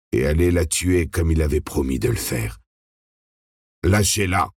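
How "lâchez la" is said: firm, no-appeal order, slightly raised; firm command. A narrator's reading. Man ordering 416 to release Ellie, firm command. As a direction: brook no argument. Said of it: "insista une voix masculine sur un ton sans appel".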